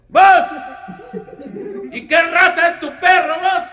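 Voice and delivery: very high, loud rural shout; high shouted bumpkin question. Acted. Comedian voicing a man from eastern Guatemala shouting a question about the dog, high and loud. Eastern peasant voiced in joke.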